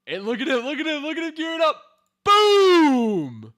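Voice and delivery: high, very loud hype building to the blows; excited shouting. Real, not acted. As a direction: scream along with the action. Hyped as character charges.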